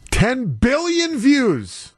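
slow, high-pitched exclamation; amazed exclamation. Real, not acted. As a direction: hit BILLION high and stretch it out in amazement. Repeats the record figure, now exclaiming.

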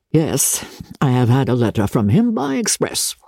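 low-voiced father; sober father. Father answers his eager daughter calmly.

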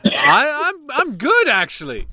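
high-pitched comic British voice; Slap Slapley. Acted. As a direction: pitch it way up, like a silly Brit. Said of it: "don't British people say".

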